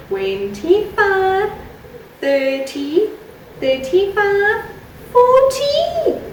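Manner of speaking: put-on sing-song counting for small children; kindergarten teacher voice. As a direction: count along brightly for little kids.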